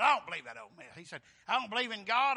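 a loud, dismissive rebuttal; loud scoffing retort. Retold or imitated. Burger King man rejecting the preacher's blessing, loudly. The big atheist guy retorts.

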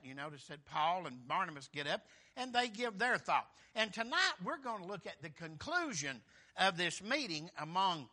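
loud preaching from the pulpit; loud preacher's voice rolling over the congregation; full-force preaching. Acted. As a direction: drive the sermon home with full force.

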